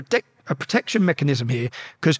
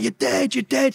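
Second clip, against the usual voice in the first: higher-pitched taunting threat; mock threat; 7 semitones higher, slower than usual. Inmates threatening him through the windows, mock threat. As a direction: jeer it without shouting.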